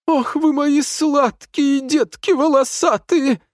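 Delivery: put-on very high, slow baby talk; singsong cooing. A narrator's reading. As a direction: coo to the pets. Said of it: "запел Лео". (умильно пропеть тонким голосом)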